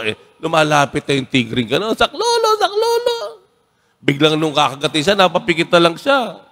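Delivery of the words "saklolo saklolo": high panicked cry for help; Tagalog: sigaw ng takot; screaming for help. A narrator's reading. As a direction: shriek it out in terror, much higher. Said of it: "Nagsisigaw siya".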